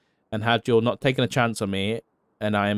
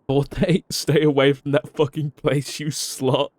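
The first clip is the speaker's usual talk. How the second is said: laughing; 4 semitones higher, slower than usual. Real, not acted. Teasing a friend in a farewell.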